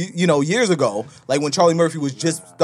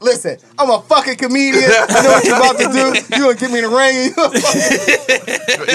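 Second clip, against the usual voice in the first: higher, louder comedian protesting; acted protest; 8 semitones higher, 5 dB louder than usual. Comedian refusing to spar with a boxer, animated protest. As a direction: push back hard, voice raised and pitched up.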